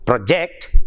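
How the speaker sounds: pro-JECT, short first syllable, rising onto JECT; second-syllable stress on project. Shown as a demonstration. Teacher repeating the verb example, stressed pro-JECT. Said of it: "stressed on the second syllable".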